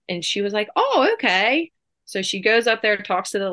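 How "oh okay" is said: high, breezy mock "oh, okay"; lightly voiced easygoing young mother; casual agreement. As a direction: toss it off lightly.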